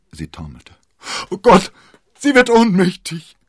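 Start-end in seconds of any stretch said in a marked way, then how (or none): 1.3-3.4 much higher and louder cry of alarm; panicked shout. A narrator's reading. D'Artagnan crying out as Constanze faints, panicked shout. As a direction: scream it in panic. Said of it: "schrie D'Artagnan".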